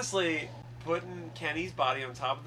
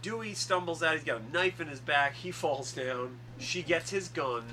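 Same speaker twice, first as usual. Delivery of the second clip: ordinary talk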